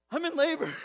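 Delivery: high, loud cry of realisation; urgent outcry. Retold or imitated. Wife waking husband with labour news, urgent and loud.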